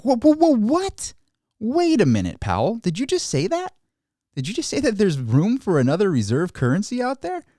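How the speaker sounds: staggered, like you can't believe what you just heard; shocked disbelief. Pretend. Shocked reaction to Powell's remark.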